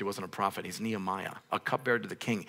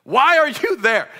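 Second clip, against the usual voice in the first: much higher, louder, slow; exclaimed question; 13 dB louder, slower than usual. Real, not acted. Preacher asking Nehemiah why he is there, exclaiming. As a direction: cry out the question in disbelief. Rhetorical outburst.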